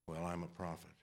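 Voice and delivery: low, hushed prophet voice; mock-solemn "certain voice" of a prophet; self-important prophet. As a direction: drop low and quiet, like a self-styled prophet. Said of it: "say that in a certain voice".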